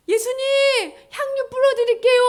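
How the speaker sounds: high, loud calling out; women calling Jesus. Acted. Women coming to anoint Jesus, high eager call.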